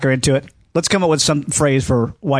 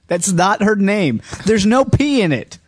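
normal talking voice

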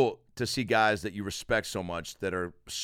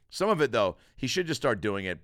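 normal talking voice

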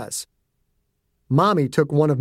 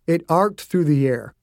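plain reading voice

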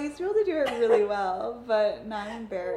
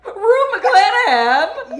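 laughing; 10 semitones higher, 16 dB louder, slower than usual. Real, not acted. Excited guess amid laughter.

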